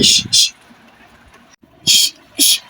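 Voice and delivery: breathy shush to drive off a bird; unvoiced shoo; shooing hiss. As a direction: hiss the parrot away. Shooing parrot.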